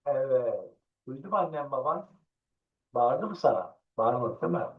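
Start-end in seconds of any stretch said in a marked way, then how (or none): none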